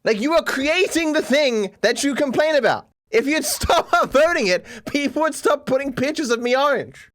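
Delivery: high, half-laughing exasperation; exasperated argument. Real, not acted. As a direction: argue back in exasperation, pitch climbing. Exasperated argument with complaining viewers.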